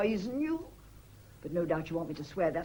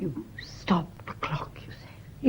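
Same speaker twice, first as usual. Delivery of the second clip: breathless, hushed disbelief; shocked whisper; 3 semitones lower, slower than usual. Acted. Wife whispers in shock to the housekeeper. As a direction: whisper it as if the news chills you.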